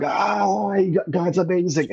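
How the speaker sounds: high, overjoyed cry; imitating his own tearful joy; ecstatic gush. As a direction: gush like you just found God. Retold ecstatic outburst, in tears.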